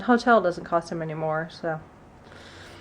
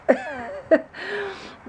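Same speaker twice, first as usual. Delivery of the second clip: rueful laugh; 12 semitones higher than usual. Real, not acted. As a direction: laugh it off, high and light. Laughing about still being sick.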